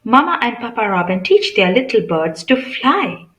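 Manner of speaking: ordinary talk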